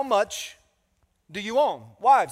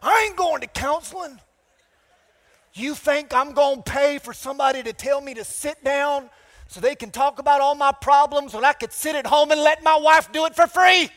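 higher, louder deep South Alabama rant; loud deep South accent; 6 semitones higher, 5 dB louder than usual. Retold or imitated. Pastor mimicking a husband refusing counseling, shouted Alabama accent. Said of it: "like, deep South from Alabama".

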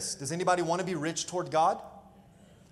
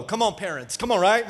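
ordinary talk, nothing marked

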